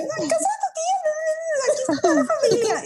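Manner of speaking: high, quick, cheerful mock mom voice; imitating her mom. Daughter imitates mom at the family gathering, high breezy mock voice. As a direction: rattle it off like an oblivious cheerful mom.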